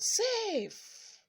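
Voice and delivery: called out high and bright; yelling. A narrator's reading. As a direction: shout it from the base like a kid. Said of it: "and yelled out".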